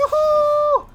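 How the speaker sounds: high loud whoop of joy. Real, not acted. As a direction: cheer it out loud. Celebrating a multikill.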